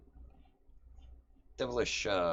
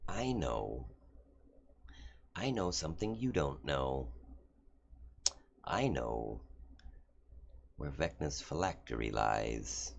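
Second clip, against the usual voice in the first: sly devil; 2 semitones lower, 7 dB quieter than usual. Acted. DM voices Asmodeus scheming.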